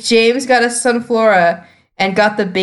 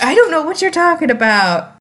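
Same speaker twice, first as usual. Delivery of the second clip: lightly acted clueless character, pitched up; Jessie; 9 semitones higher than usual. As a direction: imitate mild bafflement in a higher voice. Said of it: "Just Jessie just like".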